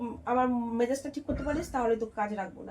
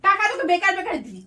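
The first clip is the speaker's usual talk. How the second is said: loud, higher-pitched telling-off; angry scolding; 5 semitones higher, 5 dB louder than usual. Acted. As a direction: scold the help over money. Employer scolding maid about money. (রাগী গলায় বকুনি)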